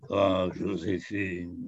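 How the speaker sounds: put-on low, slow, drawn-out French-accented croon; Charles Boyer. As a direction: draw it out low as a French screen Napoleon. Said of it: "I was Charles Boyer".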